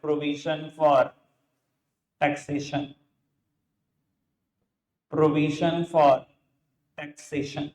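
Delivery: very slow, with long pauses; drawn-out words for students copying down; slow dictation. Real, not acted. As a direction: say it piece by piece while writing. Dictates heading while writing it.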